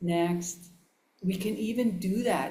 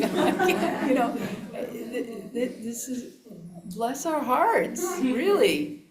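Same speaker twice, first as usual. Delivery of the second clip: high laughing, slowing down; 3 semitones higher, 4 dB louder than usual. Real, not acted. Teacher mocking the mind's habits, laughing.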